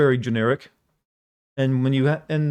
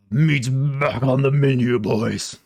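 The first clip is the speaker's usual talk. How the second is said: slow growly orc line; slow and menacing like an orc; 3 dB louder, much slower than usual. Shown as a demonstration. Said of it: "That's the best I got".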